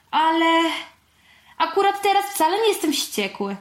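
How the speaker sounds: put-on high, helpless child's protest; sighing complaint. A narrator's reading. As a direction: sigh it out, stumped. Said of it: "westchnąłem". (z westchnieniem, bezradnie)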